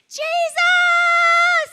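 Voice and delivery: long, very high full scream. Retold or imitated. Blind man calling Jesus through the crowd, screaming. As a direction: scream the name out, drawn out. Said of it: "So he starts screaming".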